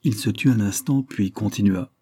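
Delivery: ordinary delivery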